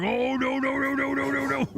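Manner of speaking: high, whiny panicked no's for the dog; voicing the scared dog. Streamer voicing his dog spooked by a runner, high whiny no's. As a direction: voice the startled dog backing off. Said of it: "she's just like".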